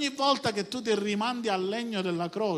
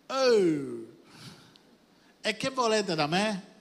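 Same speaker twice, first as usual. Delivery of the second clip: raised, exasperated comeback; indignant retort; slower than usual. Acted. Acts out Moses' possible angry retort.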